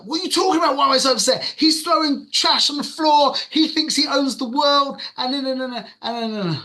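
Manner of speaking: high, loud, indignant retort; angry outburst. Retold or imitated. Snapping back at his calming friend, angry.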